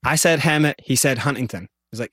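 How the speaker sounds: normal talking voice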